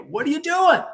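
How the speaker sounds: high, loud, indignant coach; imitated yell. Coach to the rookie sitting down, high mock yell. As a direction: holler it like a coach catching someone idle.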